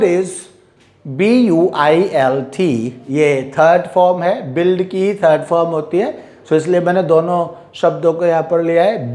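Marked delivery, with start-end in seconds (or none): none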